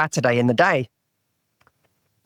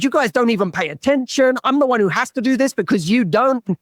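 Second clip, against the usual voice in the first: mild, higher-pitched mock angry scolding; retold anger; 6 semitones higher than usual. Hypervigilant sibling scolding oblivious brothers, mildly angry retelling. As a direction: re-enact telling off the brothers, a touch heated. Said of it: "then I got angry at them".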